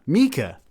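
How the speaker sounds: emphatic MEE-kah; savouring the right name; right pronunciation. Shown as a demonstration. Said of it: "not Micah".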